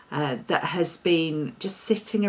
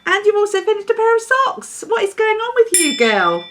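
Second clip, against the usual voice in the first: excited gushing; 12 semitones higher, 6 dB louder than usual. Real, not acted. Gushing over Celeste's finishes.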